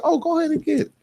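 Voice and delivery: high, light wife voice; breezy permission, mock-sweet; imitating wife. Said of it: "She was like".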